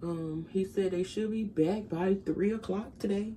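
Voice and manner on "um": usual speaking voice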